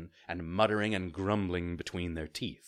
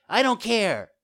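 high, loud bratty retort; defiant child; 13 semitones higher, 8 dB louder than usual. A narrator's reading. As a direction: throw it back like a stubborn child. Puppet defies the fairy.

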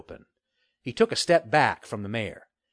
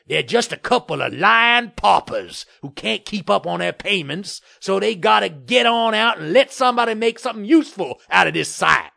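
loud, higher-pitched blustering developer; scornful bluster; 8 semitones higher, 7 dB louder than usual. A narrator's reading. Developer dismissing the family to the mayor, scornful bluster. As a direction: rant with contempt, hitting words hard. Murtaugh's figure voice.

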